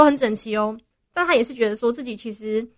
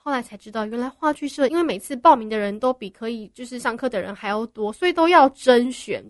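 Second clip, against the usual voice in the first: ordinary delivery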